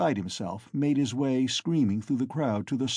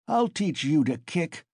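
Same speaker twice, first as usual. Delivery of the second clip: raised-pitch fierce threat; ferocious shout; 6 semitones higher than usual. A narrator's reading. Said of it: "Mikolka shouted ferociously".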